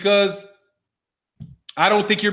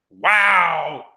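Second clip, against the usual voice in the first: ordinary talk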